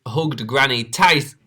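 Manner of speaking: ordinary delivery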